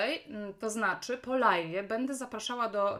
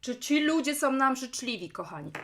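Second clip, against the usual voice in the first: usual speaking voice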